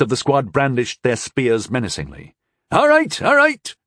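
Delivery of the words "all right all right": very high, hurried panic; a frightened watchman caving in; panicked. A narrator's reading. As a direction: give in, terrified. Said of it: "agreed the man in panic".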